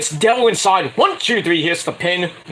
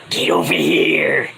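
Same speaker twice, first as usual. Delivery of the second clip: creepy villain growl; slower than usual. Retold or imitated. Creepy wrestler grabbing the announcer.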